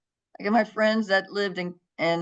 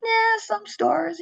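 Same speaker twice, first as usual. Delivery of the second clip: much higher and slow, oblivious; a slow, high, absent-minded reply; vague casual answer; 14 semitones higher, much slower than usual. Retold or imitated. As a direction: let it trail out unaware. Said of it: "And I was like".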